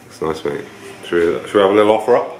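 ordinary talk

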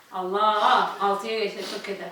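groaning through a packed mouth; mumbled with cheeks crammed; cheeks stuffed full. Real, not acted. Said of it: "Ağzım patlayacak".

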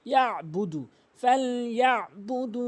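ইয়াবুদু with a throat squeeze after ya; correct ayn. Shown as a demonstration. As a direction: press "ya'-budu" from mid-throat. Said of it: "হলকের মাঝখান থেকে চাপ দিয়ে".

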